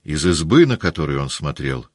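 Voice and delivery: usual speaking voice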